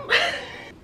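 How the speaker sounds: a short high burst of laughter. Real, not acted. Laughs at her irony.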